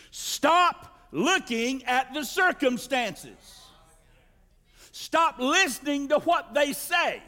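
slow, forceful commands; stern sermon charge; commanding preaching. Acted. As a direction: drive each stop home. Commanding series of stops.

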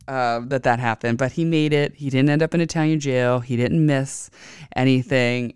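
ordinary delivery, nothing marked

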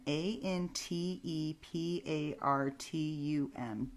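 slow, letter by letter; spelling it out. Shown as a demonstration. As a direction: spell each letter out clearly. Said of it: "so I'm gonna spell it for you".

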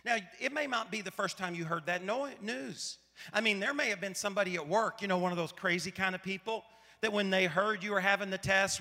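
ordinary talk

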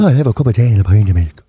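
low, fast, loud Mayo accent impression; full-on Mayo accent. Shown as a demonstration. Showing co-host how Mayo sounds, exaggerated Mayo accent. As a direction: rattle it off in thick Mayo. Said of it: "is that a Mayo accent?".

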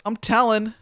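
high, loud tattletale cry; tattling yell. Acted. Linda running out to report you, tattling yell. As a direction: call it out shrilly while fleeing.

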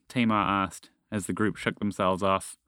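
usual speaking voice

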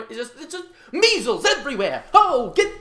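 loud, high hammed-up hype; forced enthusiasm. Host trying to get enthusiasm going on the show, with a loud, high hype voice. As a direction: pump it up like a showman. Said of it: "trying to get some enthusiasm going".

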